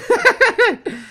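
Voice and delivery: high, bitter burst of laughter; rueful laugh. Real, not acted. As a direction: laugh at how hopeless it is. Laughing at own bad luck.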